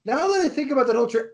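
high, fast excited gushing; screaming crying fan. Real, not acted. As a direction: rush it out like an overjoyed fan. Gushing fan over favorite movie.

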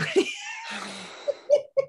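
high, helpless burst of laughter; real laughing fit. Real, not acted. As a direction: crack up before the story can go on. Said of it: "I can't get through it without laughing".

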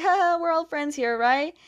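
high-pitched, forced friendly chuckle; mock nervous cheer. Aang to the rivals, calming a standoff, mock nervous cheer. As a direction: play the awkward peacemaker, voice pitched well up. Peacemaker trying to defuse a standoff.

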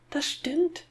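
very high put-on little girl voice; high girl's voice. A narrator's reading. Boy speaking as his sister to his father, high girl's voice. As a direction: squeak it up like a girl. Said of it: "mit der hohen Stimme eines Mädchens".

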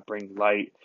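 ordinary talk, nothing marked

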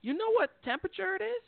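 high mock southern granny voice; sweet old southern lady. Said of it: "This little old lady comes out".

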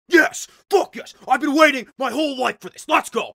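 loud, high, hyped-up shouting; hammed-up ecstatic outburst; amped. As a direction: explode like an overeager volunteer. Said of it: "like, amped".

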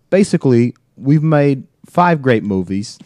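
a hammed-up British accent, imitating Tom Holland. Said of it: "I gotta do this in a British accent".

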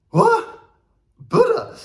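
high, loud squawk of disbelief; incredulous reaction. Retold or imitated. Acts startled reaction to mishearing.

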